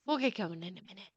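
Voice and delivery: quick, soft mumbled put-off; Brandon brushing off. Acted. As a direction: toss it away, still lost in the moment. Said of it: "Brandon just kind of was like".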